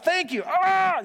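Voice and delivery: high, loud, drawn-out pained cry; pained shout. Retold or imitated. Pastor leaping out at the ER, loud and pained. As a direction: cry out thanks in agony. Jumping out at ER in pain.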